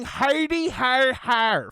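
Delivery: high, spoken "hardy har" fake laugh; mock laughter. Mimicking someone laughing at accents, high mocking fake laugh. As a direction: imitate a jeering dumb laugh in words. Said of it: "you're laughing about it going".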